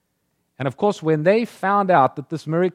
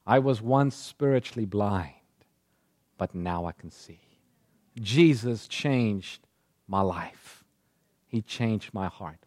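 tender testimony; 7 semitones lower, 5 dB quieter, slower than usual. Acted. Preacher voices a believer's testimony.

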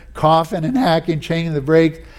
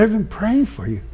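softer and higher, tender and earnest; gentle repeat; 5 semitones higher, 4 dB quieter than usual. Retold or imitated. Repeating it softly to his puzzled friend, tender. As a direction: say it again gently, like a confession.

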